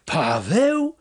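high, drawn-out cry rising sharply; amazed question, swooping upward; astonished yell. A narrator's reading. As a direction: yell the name in disbelief. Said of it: "wrzasnął".

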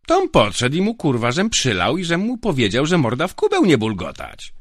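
very high, loud, lout's voice; loud drunken boasting. Acted. Drunk guard boasting how he shut the prisoner up, loud. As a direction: brag at full volume, waving arms.

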